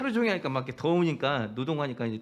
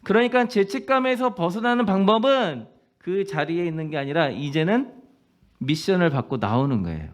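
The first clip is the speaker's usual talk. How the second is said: fervent preaching; 3 semitones higher, 5 dB louder than usual. Real, not acted. Pastor urging the congregation, fervent preaching. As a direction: drive the message home from the pulpit.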